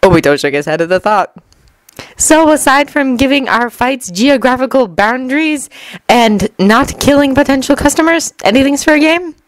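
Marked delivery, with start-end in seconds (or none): 2.2-9.4 high-pitched questioning voice; Temari. A narrator's reading. Temari asking Obito about the rules, high voice. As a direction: pitch it well up, ending on a question.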